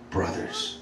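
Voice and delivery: hushed, very soft; tender address. Acted. Jesus calling his disciples after resurrection, hushed and tender. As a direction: softly call them in a near murmur.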